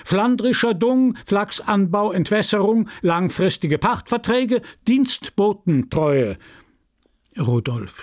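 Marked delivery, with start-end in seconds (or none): 0.0-6.4 high-pitched list, called out item by item; hurried chairman. A narrator's reading. Chairman hurries through the prize categories, calling out. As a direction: rattle off the categories officially. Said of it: "Er beeilte sich".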